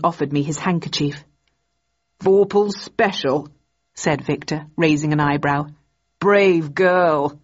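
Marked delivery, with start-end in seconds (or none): 2.2-3.5 lightly voiced genial old gentleman; hearty, courteous old man; older gentleman voice. A narrator's reading. Victor's voice in the story. 6.2-7.4 lightly voiced genial old gentleman; hearty, courteous old man; older gentleman voice. A narrator's reading. Victor's voice in the story.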